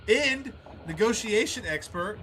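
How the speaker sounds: sudden high loud disbelief; blurting out shock at the ending; surprised. Real, not acted. Gamer shocked when the game suddenly ends, high and loud.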